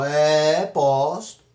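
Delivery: low, drawn-out grudging yes; sheepish admission. Acted. Pastor admits posting to a questioner, sheepish admission. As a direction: own up reluctantly, voice dropped.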